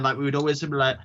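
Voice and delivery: ordinary delivery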